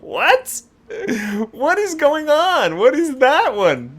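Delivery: high, slow baffled disbelief; shocked by what she sees, pitch up high; baffled surprise. Real, not acted. Reacting to a wild hairstyle on screen, high baffled shock.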